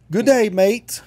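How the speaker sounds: put-on high, loud kids' Aussie greeting; kids' Australian accent. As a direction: mimic kids calling out in an Australian accent. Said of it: "and they all do the voice".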